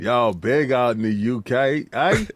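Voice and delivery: low, mock British-tinged accent; half-hearted UK accent; put-on accent. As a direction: tease them in a fake UK accent. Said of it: "I don't like you in this accent".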